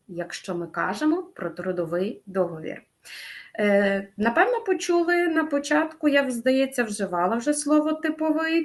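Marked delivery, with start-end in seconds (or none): none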